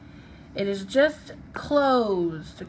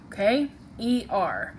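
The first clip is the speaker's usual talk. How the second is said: ordinary delivery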